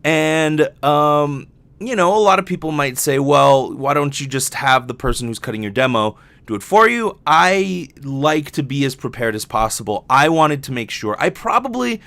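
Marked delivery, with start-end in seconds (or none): none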